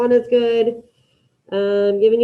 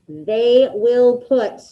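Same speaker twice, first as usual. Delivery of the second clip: slow dictation; much slower than usual. Shown as a demonstration. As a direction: stretch each word for the class. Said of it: "figure out which verb means put".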